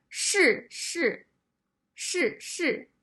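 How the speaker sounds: 是是 with two sharp falling tones; 是是 said shì shì, both falling; two fourth tones. Shown as a demonstration. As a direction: drop each 是 hard from high. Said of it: "also the fourth tone".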